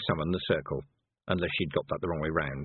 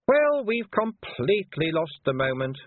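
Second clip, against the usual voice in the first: slow, high, exasperated complaint; put-out, huffy and drawn out; peeved; 10 semitones higher, 4 dB louder, slower than usual. A narrator's reading. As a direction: drag it out in annoyance, higher voice. Peeved leader gives up.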